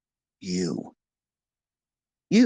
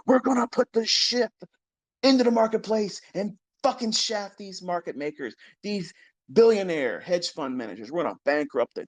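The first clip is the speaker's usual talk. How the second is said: mocking the herd; 6 semitones higher than usual. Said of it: "that herd mentality".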